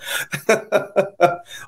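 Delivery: a short laugh at the punchline; breathy chuckle after the story; amused laugh. Real, not acted. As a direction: laugh at the joke just told. Laughing at his own story.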